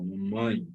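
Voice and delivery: teacher's low nasal demonstration of "mamãe"; nasal vowel. Shown as a demonstration. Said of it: "você utiliza a parte nasal".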